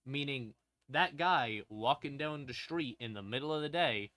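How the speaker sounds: ordinary talk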